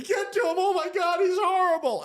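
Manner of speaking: very high, loud panicked shriek; hysterical screaming. Retold or imitated. Crying women shrieking at paramedics about the victim, very high and loud. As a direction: scream for the medics in horror. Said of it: "these two girls start crying".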